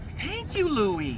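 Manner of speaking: put-on high frail old lady. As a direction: call out like a tiny old widow. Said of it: "And she said".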